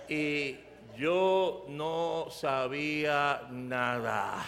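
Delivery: very slow, stretched words; slow, low, drawn-out confession; drawn-out admission. Real, not acted. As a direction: stretch each word to confess total cluelessness. Stressing his total ignorance of navigation.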